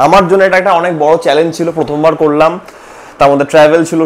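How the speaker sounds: loud, joyful news that the fast is done; excited announcement. Real, not acted. (উত্তেজিত হয়ে জোরে ঘোষণা)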